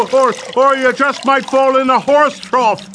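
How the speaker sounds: high, gleeful ribbing; jeering taunt. Acted. Man teasing Mulligan about a horse trough, jeering. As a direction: taunt him with barely held laughter, pitched high.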